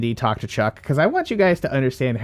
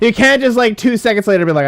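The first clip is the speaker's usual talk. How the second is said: loud, high-pitched protest; worked-up, incredulous rant; exasperated scolding; 8 semitones higher, 7 dB louder than usual. Real, not acted. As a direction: call out the player, voice raised. Scolding a player for backtracking.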